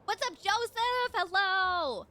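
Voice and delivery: high, loud, delighted hello to a viewer; excited greeting. Real, not acted.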